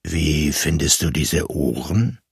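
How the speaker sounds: low, grave voice speaking close by; deep serious voice. A narrator's reading. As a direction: say it low and earnest, like a stranger inspecting. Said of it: "Die Stimme war tief und ernst".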